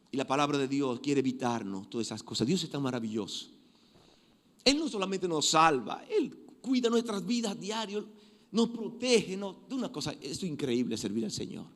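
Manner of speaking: ordinary talk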